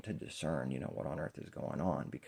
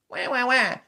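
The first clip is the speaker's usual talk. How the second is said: high, loud "wah" bawling; rapid put-on baby cries; mock baby wail; 12 dB louder than usual. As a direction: squall like an infant, hammed-up. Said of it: "God baby".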